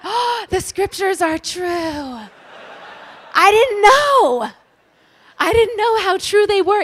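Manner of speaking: hammed-up wonder at a punchline; mock revelation. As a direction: gush like a joke epiphany. Mock revelation about scripture.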